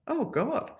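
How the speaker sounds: high-pitched retold dismay; taken aback. Acting out his startled reaction.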